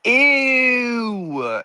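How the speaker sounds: long, high-pitched squeal of disgust; mock disgust. Kid reacting to stretched elbow skin, exaggerated disgust. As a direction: draw it out high, sliding down. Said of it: "you'd be like".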